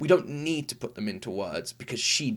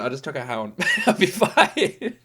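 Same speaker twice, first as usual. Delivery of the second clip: laughing quote; 6 semitones higher, 5 dB louder than usual. Retold or imitated. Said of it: "I was like".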